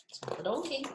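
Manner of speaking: plain reading voice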